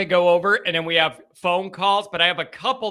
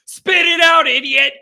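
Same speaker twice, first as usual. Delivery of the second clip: loud, high burst of self-annoyance; exasperated shout at oneself; self-scolding; 10 semitones higher, 8 dB louder than usual. Real, not acted. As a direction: yell at yourself for fumbling the words. Scolds himself after stumbling over name.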